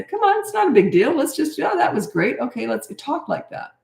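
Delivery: lightly voiced supportive parent at homework; encouraging coach voice. Shown as a demonstration. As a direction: cheer the child on gently. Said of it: "Uh, say".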